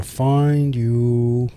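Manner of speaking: slow, menacing villain voice; evil voice. Acted. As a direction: draw it out like a cackling villain. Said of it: "That's my evil voice".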